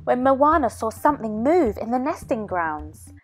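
usual speaking voice

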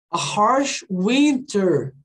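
A harsh winter read very slowly; slow model reading. Shown as a demonstration. Teacher reading the phrase slowly before translating. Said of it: "um inverno difícil".

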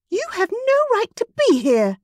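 high-pitched, loud angry outburst; furious rival. A narrator's reading. Jealous rival hisses at Hannah in a high, loud fury.

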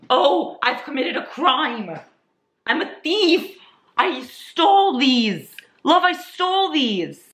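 high, drawn-out confession of a crime; mock wail. Confessing to viewers an accidental theft, mock wail. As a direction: wail it slowly in mock horror.

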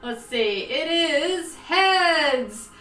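ordinary talk

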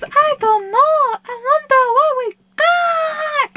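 high, whimpering little bear voice; a small bear on the edge of tears; whiny toy bear. Pretend. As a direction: whine it like a sad little teddy.

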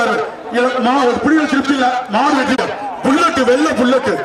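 ordinary talk

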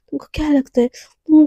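hushed, intimate whisper. Acted. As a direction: lean in and whisper the challenge. Intimate possessive confrontation.

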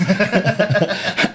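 short laugh. Real, not acted. Laugh about the police.

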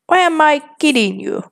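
ordinary talk

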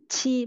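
"chi" with a hard puff of air; strongly aspirated. Shown as a demonstration. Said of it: "bật hơi mạnh ra nào".

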